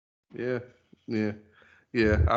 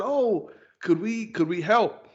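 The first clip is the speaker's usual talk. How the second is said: lightly voiced kids, higher pitch; a hint of kids offering help, pitched up; eager kids asking; 8 semitones higher, slower than usual. Neighborhood kids asking to help shovel, eager and higher.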